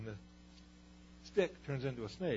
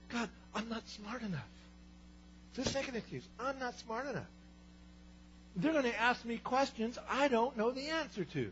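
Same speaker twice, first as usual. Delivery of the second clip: higher, worried complaint; anxious whine; 9 semitones higher than usual. Retold or imitated. Moses telling God he's not smart enough, high and worried.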